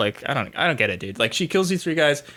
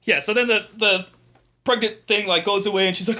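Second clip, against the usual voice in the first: normal talking voice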